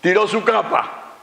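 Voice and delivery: high-pitched, emphatic sermon climax; impassioned preaching. Acted. Preacher telling why the blind man dropped his cloak, impassioned preaching. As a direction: preach it with rising fervour, drawing out key words.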